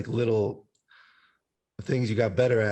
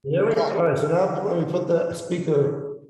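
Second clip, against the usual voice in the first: cave echo; 6 semitones higher, slower than usual. Real, not acted. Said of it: "You sound like you're in a fucking cave".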